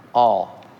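"all?" dropped low but punched; stress on all. Shown as a demonstration. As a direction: hit the single word "all". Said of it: "can I just emphasize that word".

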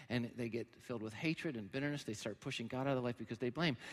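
ordinary delivery

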